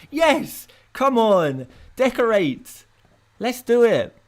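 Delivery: loud, high, fired-up cheering; excited cheer. Real, not acted. Streamer cheering himself on to decorate, loud and excited. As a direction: pump yourself up to decorate.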